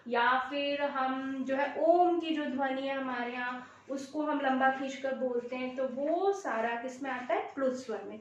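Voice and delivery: usual speaking voice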